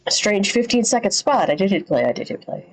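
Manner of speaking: ordinary delivery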